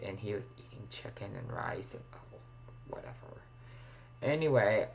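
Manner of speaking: ordinary talk, nothing marked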